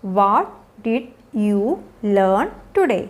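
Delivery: very slow, low, every word isolated. Shown as a demonstration. Teacher modelling an after-school question for learners, word by word.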